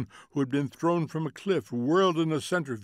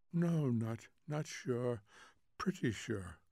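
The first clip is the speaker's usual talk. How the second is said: soft, faltering, sleepy answer; drowsy hedging; 8 dB quieter than usual. A narrator's reading. Wife unsure the girl is dead, drowsy and soft. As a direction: let the certainty fade out.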